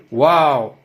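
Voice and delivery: usual speaking voice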